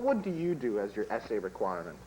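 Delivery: ordinary talk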